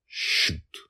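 urgent whispered order. Acted. Father urges daughter from the woods, whispered. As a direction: hiss the command from hiding. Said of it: "heard Dragonfather whisper".

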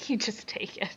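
breathy laughing whisper. Real, not acted. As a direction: whisper it with a giggle.

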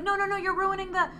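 high, squeaky protest, well above his voice; a frantic little no-no-no; panicked protest. Retold or imitated. Character objects to ruined footprints.

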